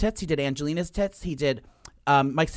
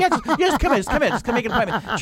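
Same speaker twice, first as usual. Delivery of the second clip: brushing off; 6 semitones higher, 3 dB louder, faster than usual. Retold or imitated. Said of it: "He's like".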